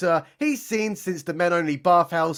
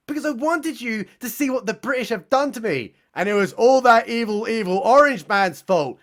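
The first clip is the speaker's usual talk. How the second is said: high, louder rising indignation, pushing hard on evil; indignant complaint; 5 semitones higher, 4 dB louder than usual. Acted. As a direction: whine the blame at the police. Jussie blaming the orange man.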